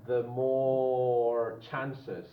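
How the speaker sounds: drawn out, word by word; slow dictation. Shown as a demonstration. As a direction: spell it out slowly for the board.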